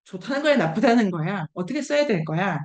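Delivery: ordinary delivery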